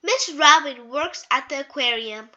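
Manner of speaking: ordinary delivery, nothing marked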